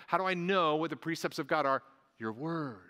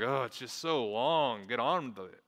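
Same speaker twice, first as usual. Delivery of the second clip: impatient cynic. Retold or imitated. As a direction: imitate a bored cynic groaning about length. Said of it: "some cynics come to Psalm".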